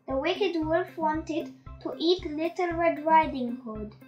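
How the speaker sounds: plain reading voice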